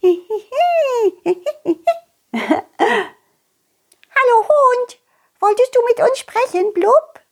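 high, quick little fish chirping a question; a curious tiny fish calling up from the water. Acted. Fish greets the dog.

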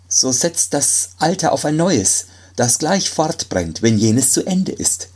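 high, begging doctor; pleading. Acted. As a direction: plead with Death desperately. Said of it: "bat der Arzt". (flehend, hoch)

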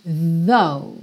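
"though" with the tongue touching the front teeth; th sound. Shown as a demonstration. A teacher modelling the TH in "though". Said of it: "linguinha lá nos dentes da frente".

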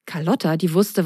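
ordinary delivery, nothing marked

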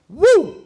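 shrill falsetto cheer, loud; loud high whoop. Real, not acted. Cheering himself after finishing the rap, loud whoop.

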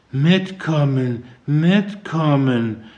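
slow, high, mumbled, as if in a daze; dazed mumble. A narrator's reading. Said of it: "nuschelte sie vor sich hin".

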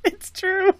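high squeaky laugh through words. Real, not acted. Laughing agreement.

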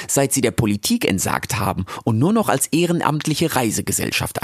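Frau Jaki. Acted. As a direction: speak as a brisk, friendly guide. (lebhafte Frau Jaki, höher gesprochen)

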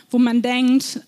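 ordinary talk, nothing marked

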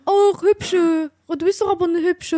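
a hint of a sleazy stranger sweet-talking; mocking a pushy drunk. Retelling how the stranger hit on her, mock sleazy voice.

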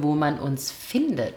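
whispered question; whispering. Acted. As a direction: ask it in a whisper. Whispered request.

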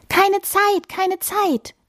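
high, busy bird voice; hurried titmouse. A narrator's reading. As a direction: brush them off in a high chirp. Busy bird brushes off flake. (gehetzte, hohe Vogelstimme)

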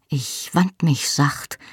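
ordinary delivery, nothing marked

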